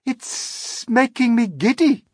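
high, slow, loud complaint; plaintive call from a height. A narrator's reading. Miss Spider dizzy up on the peach, plaintive call. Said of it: "Miss Spider called out".

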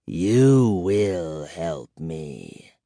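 low, slow and soft; soft, slow old vampire menace. A narrator's reading. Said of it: "he said softly".

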